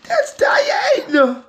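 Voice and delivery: high, slow excited call; excited shout. Real, not acted. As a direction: announce it again, thrilled. Repeats reveal.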